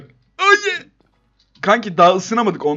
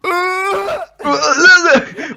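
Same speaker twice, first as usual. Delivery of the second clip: loud laugh; 11 semitones higher, 5 dB louder than usual. Real, not acted. Laughing after making the jump.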